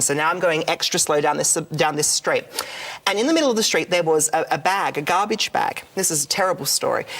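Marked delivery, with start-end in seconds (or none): none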